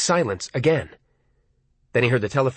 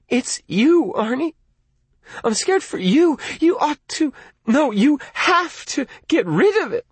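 scared plea; 10 semitones higher than usual. Acted. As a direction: beg him out of fear. Lee scared and pleading.

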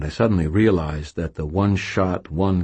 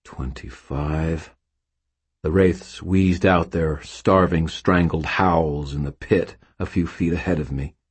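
ordinary delivery

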